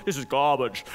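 mock English accent, low and understated. Imitating a TV chef tasting the food, English accent. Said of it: "he says it with the English accent".

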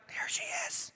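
gossipy hushed whisper. Retold or imitated. Churchgoers gossiping as the woman walks in, whispered. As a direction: whisper it behind your hand.

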